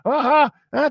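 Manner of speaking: cri aigu et agressif, beaucoup plus haut et plus fort; provoque-la en la poussant vers le coin; cri provocateur imité. Retold or imitated. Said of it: "en criant". (cri de défi moqueur, très aigu)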